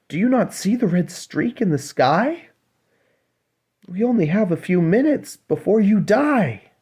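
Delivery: high, pressing sisters pleading in a rush; urgent mermaid sisters. Acted. Sisters urging the little mermaid to save herself, high and pleading.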